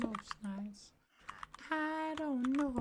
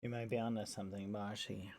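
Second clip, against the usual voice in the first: deep character voice; 6 dB quieter, much faster than usual. Acted.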